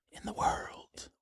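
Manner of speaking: slow, hushed whisper; mock-dramatic whisper. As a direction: whisper it like a grand secret. Mock-dramatic boast, take whispered.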